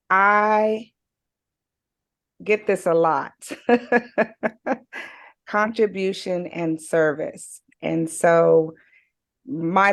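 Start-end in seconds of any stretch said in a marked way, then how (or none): 3.5-5.1 light, high-pitched laugh; amused run of short laughs; real chuckle. Real, not acted. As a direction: laugh at getting this a lot. Wry admission of getting this often.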